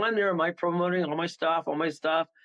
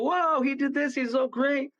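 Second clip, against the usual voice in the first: higher, mock-awed praise; gushing; 10 semitones higher, slower than usual. Psychics crowding round the tent, gushing in mock awe. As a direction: gush like an impressed crowd. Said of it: "all the psychics go".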